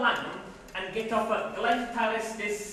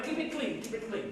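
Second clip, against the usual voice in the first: hushed warning; 3 semitones higher, 7 dB quieter than usual. Acted. Recruiter warning the navvy about his joke, hushed. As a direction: drop to a near whisper. Warning, fading to near whisper.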